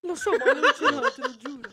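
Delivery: laughing through a high, loud protest; giggling, half-guilty I-swear; laughing denial. Real, not acted. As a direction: swear you don't have it while cracking up. Denying he has the letter.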